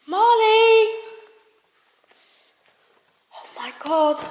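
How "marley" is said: high, loud sing-song call of a name. Real, not acted. Girl searching outside for her dog, calling loudly. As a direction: call out far into the night.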